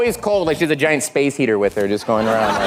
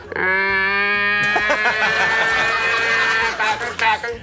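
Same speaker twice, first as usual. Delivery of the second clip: much higher, clucking creature call; imitated Tauntaun call; 9 semitones higher than usual. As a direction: squawk it like a snow beast. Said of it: "it's like a".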